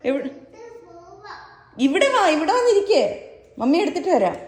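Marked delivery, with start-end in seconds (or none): 1.8-3.0 sing-song call, come here; high coaxing call. Real, not acted. Mother calls her wandering toddler back, high coaxing call.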